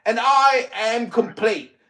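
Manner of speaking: furious mock English accent. Raging at a threat on Clubhouse, in a fake English accent. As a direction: blow up at him in a put-on English accent. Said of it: "my English accent is so mocking".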